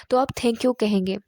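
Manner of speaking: ordinary talk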